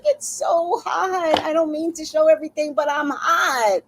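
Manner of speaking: high, exaggerated plea; playful high-pitched whine; whining about the heat. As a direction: plead it high, stretching the key words. Retold complaint about heat.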